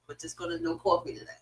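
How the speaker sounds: normal talking voice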